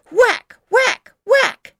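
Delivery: whack, whack, whack shouted high and loud; a kid yelling sound effects for her story. Acted. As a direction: bellow each whack like the axe falling. Child acting out giant's chops.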